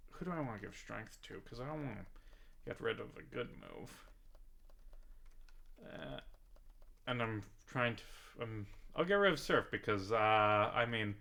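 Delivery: quiet distracted mutter to self; muttering aside. Real, not acted. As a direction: mumble to yourself while choosing a move. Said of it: "aside while playing".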